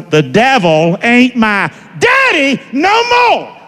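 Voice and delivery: slow, high preacher's shout. Shown as a demonstration. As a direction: shout it out word by word, pitch soaring. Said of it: "Can you say it a little louder?".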